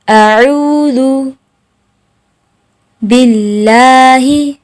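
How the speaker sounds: अऊदु drawn out slowly, syllable by syllable; slow drilled recitation. Shown as a demonstration. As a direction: stretch अऊदु long and even. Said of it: "अब मेरे साथ मशक करें". (अऊदु को धीरे खींचकर)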